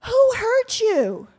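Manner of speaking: high, loud, slow pity; imitating a preachy hiker; condescending stranger. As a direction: talk down with mock concern. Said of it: "she says".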